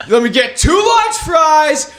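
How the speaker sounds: mock shout of a Whopper order; rowdy drunk order.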